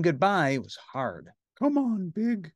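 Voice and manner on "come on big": higher coaxing lady voice; coaxing caseworker; Miss Finch. A narrator's reading. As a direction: urge him along, high and light. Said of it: "said Miss Finch".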